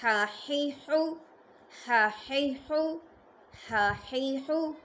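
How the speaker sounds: slow throaty ha hee hoo drill; from the throat. Shown as a demonstration. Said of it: "हलक से अदा होगा".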